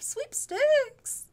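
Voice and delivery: high, loud game-show cry; hype shout. Acted. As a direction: announce it like a prize host. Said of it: "I don't know what that was either".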